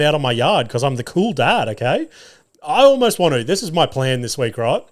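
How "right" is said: normal talking voice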